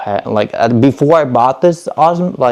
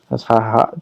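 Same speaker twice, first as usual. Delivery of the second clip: normal talking voice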